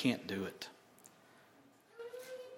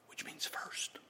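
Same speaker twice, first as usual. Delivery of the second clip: whisper; 13 dB quieter, slower than usual. Acted. Aside explaining a scripture word.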